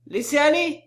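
loud far-off command; commanding from afar. A narrator's reading. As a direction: call out the order from a distance. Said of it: "commanda de loin le brigadier".

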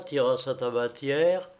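plain reading voice

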